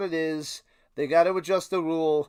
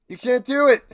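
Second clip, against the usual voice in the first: louder, higher outraged repeat; raised voice at the refs; loud protest; 6 semitones higher, 6 dB louder than usual. Real, not acted. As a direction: hammer the complaint home.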